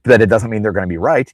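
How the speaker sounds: ordinary talk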